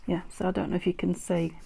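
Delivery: normal talking voice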